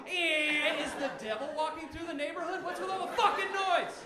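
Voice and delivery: loud, high, exasperated yelling; angry shout. Acted. Hungover sleeper yelling at noisy birds, angry shout. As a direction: yell out the window at the racket. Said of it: "woken by noise, yelling".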